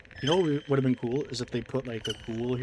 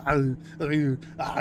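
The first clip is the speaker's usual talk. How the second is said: louder grunting "Ah" sounds as Arnold; Schwarzenegger grunts; 2 semitones higher, 4 dB louder, much slower than usual. Retold or imitated. Streamer practising Arnold's signature grunt, louder. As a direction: grunt like a strained action hero. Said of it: "a Schwarzenegger impression".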